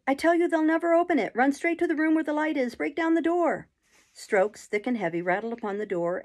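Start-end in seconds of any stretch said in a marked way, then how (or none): 0.1-3.7 high, loud, urgent yelling; screaming. A narrator's reading. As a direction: scream directions to the crowd outside. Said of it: "screamed the boy".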